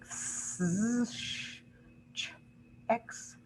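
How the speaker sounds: drawn-out S, SH, then a short CH; teacher sounding out sibilants one by one; isolated hissing sibilants. Shown as a demonstration. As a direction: demonstrate each hissing consonant by itself. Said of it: "hissing type of sounds".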